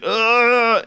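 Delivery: mock fall grunt.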